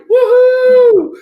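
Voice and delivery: high, loud triumphant whoop; loud victory whoop; celebrating woohoo. Real, not acted. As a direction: cheer for getting the name right. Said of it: "I said it right".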